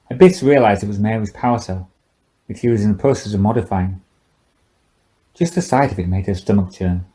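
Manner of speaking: ordinary delivery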